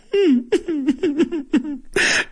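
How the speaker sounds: high, mock whimpering in short bursts; demonstrated whimper. Shown as a demonstration. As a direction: fake a long, high little whimper. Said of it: "to whimper is lloriquear".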